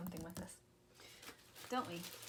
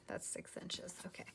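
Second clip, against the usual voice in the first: near-whispered measuring aside; hushed note to self; muttering to self; 11 dB quieter than usual. Real, not acted. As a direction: say it under your breath to yourself. Measuring, talking to herself.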